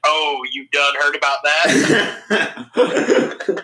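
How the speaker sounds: slow southern drawl impression. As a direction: drag it out, smug and slow. Mocking Aaron Williams in a movie.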